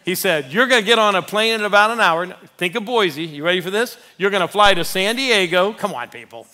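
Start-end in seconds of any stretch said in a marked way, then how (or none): 0.5-2.2 louder and higher, fast, bursting with the news; excited quote. Retold or imitated. Pastor tells the congregation the agent's news, excited and fast. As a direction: deliver it like thrilling news.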